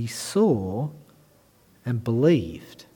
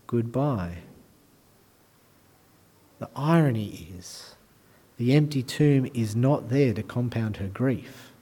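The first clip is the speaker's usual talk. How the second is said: ordinary delivery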